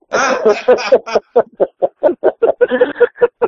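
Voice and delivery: a long wheezy laugh at his own joke; real laughter after a boast. Real, not acted. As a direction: let the laugh roll on and fade.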